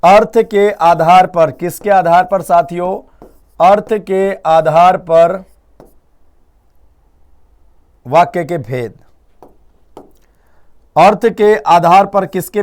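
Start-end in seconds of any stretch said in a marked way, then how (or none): 0.0-1.5 slow, even "अर्थ के आधार पर", each word spaced for writing; slow dictation. Shown as a demonstration. Teacher dictating the exam heading to students, slowly. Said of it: "पहला question तो यही आएगा". 3.7-8.9 slow, even "अर्थ के आधार पर", each word spaced for writing; slow dictation. Shown as a demonstration. Teacher dictating the exam heading to students, slowly. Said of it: "लिखिए जी".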